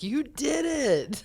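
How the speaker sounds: slow, high hushed cheer; a hint of fawning fans; mock proud praise. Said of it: "Everyone else is like".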